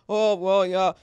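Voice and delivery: coach's monotone press-conference mumble, pushed out; hammed-up level monotone of a football coach; Bill Belichick. Host doing a terrible impression of a coach, droning monotone. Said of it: "it's what he sounds like".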